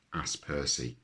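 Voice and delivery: ordinary delivery, nothing marked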